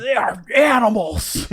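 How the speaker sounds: slow, high-pitched drawn-out impression yell; Josh Brolin. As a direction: stretch the words into a hammed-up shout. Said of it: "I love doing his voice".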